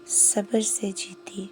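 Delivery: usual speaking voice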